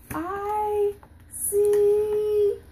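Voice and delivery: loud, high drawn-out peekaboo reveal; playful peekaboo. Pretend. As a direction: build to a big, long final word. Peekaboo climax with child.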